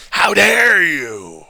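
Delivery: slow, loud preacher rant; slow, loud, threatening preacher tirade; practicing a speech. Shown as a demonstration. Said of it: "let me try that again".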